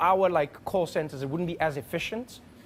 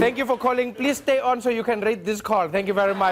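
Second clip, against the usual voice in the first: higher, louder customer-service sign-off; South African operator; 5 semitones higher, 4 dB louder than usual. Acted. Operator closing the cat call, officiously polite. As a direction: recite it like a call center script. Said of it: "South African operators doing nine one one".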